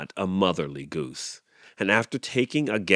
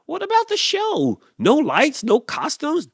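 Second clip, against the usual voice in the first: very high, louder fretting; anxious rooster; 5 dB louder than usual. A narrator's reading. Rooster fretting about the show, very high worry. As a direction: fret it out squeaky. Clyde worried questions.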